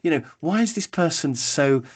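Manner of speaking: high-pitched mock exasperation; exasperated analytical. Analytical thinking about a disorganized enthusiast, exasperated. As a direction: imitate a baffled analytical, pitch climbing as it builds. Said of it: "find them a bit of a nightmare".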